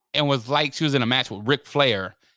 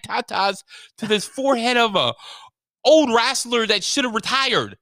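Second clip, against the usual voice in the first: higher pitched amused rant; mild worked-up rant; 9 semitones higher than usual. Retold or imitated. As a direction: rattle it off, half laughing. Ranting to brother.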